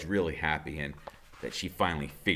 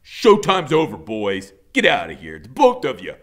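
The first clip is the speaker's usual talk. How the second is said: louder, firm order; loud dismissal; 3 semitones higher, 13 dB louder than usual. A narrator's reading. Iggy ordering the boys out, loud and firm. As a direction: throw them out.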